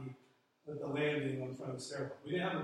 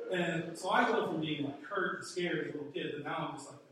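ordinary talk

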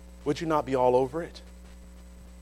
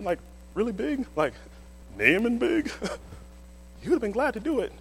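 a hint of a timid servant; 6 semitones higher than usual. Servant cautiously addressing Naaman.

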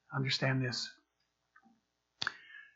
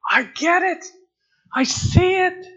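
excited convert; 12 semitones higher, 5 dB louder than usual. Pretend. New believer suddenly understanding.

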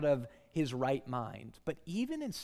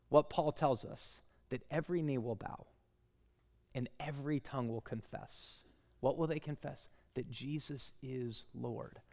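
usual speaking voice

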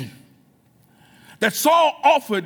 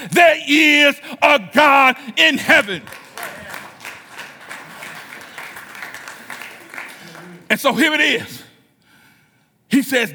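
whooping sermon climax, shouting to the church; full-force preaching; 3 semitones higher than usual. Acted. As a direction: build the congregation up, calling out.

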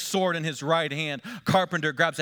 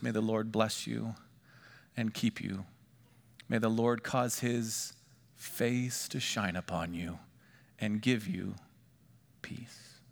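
softly spoken blessing; 6 semitones lower, 7 dB quieter than usual. Acted. Reciting the priestly blessing.

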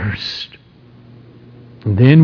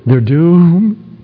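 ordinary talk

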